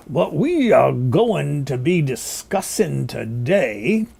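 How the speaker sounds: very slow, drawn-out preacher cadence; Al Sharpton impression. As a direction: stretch every word like a slow sermon. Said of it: "as slowly as the Reverend Al Sharpton".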